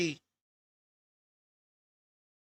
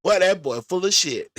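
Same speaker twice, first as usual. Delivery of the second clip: normal talking voice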